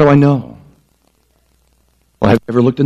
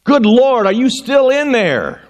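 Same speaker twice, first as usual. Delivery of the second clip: retold dad yelling; 9 semitones higher, slower than usual. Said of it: "I hear him yelling".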